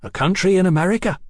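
quick, high, eager reply; ready answer. A narrator's reading. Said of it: "Hetty said readily".

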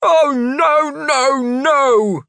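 high, loud panicked cry; panicked shout. A narrator's reading. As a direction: yell it in panic. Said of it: "Heelkick shouted".

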